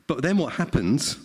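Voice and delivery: ordinary delivery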